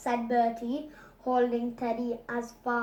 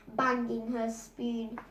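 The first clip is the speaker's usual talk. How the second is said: usual speaking voice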